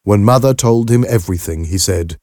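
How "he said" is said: plain reading voice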